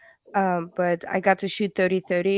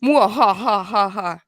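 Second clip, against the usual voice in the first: mock evil cackle; 5 dB louder than usual. Mock villain laugh at comment.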